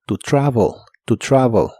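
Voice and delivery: "To travel" with the e almost like o; "to travel" con e casi o; pronunciation demo. Shown as a demonstration. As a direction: round the last vowel of "travel" toward o. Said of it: "suena casi como O".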